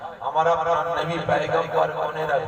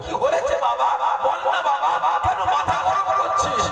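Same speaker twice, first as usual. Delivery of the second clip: high, heated exchange; agitated son and mother; 5 semitones higher than usual. Acted. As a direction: argue up high and tense. Son and mother voiced quarrel. (উত্তেজিত উঁচু গলায় কথোপকথন)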